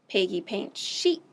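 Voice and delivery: usual speaking voice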